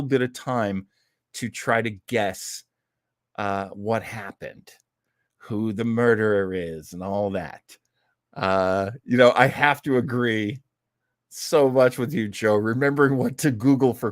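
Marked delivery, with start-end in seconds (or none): none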